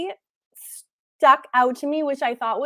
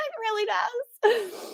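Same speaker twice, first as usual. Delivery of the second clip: laughing; 8 semitones higher, 6 dB quieter, slower than usual. Real, not acted. Laughing at her robe look.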